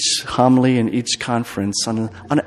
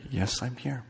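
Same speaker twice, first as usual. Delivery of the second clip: soft reassurance; 15 dB quieter than usual. Acted. Parent's soft reply.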